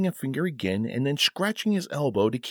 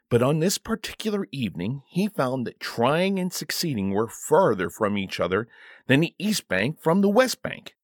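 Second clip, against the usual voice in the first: plain reading voice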